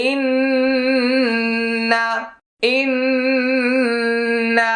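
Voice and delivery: ইন্না with a long held nasal ন্ন; ইন্না, long nasal ghunna on ন্ন. Shown as a demonstration. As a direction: hum the doubled ন্ন in ইন্না through the nose. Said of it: "এটা হলো ওয়াজিব গুন্না".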